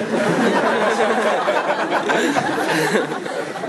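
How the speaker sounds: audience roaring with laughter; big crowd laugh. Real, not acted. Crowd laughing after punchline.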